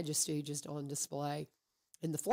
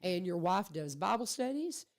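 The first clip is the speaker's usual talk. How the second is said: usual speaking voice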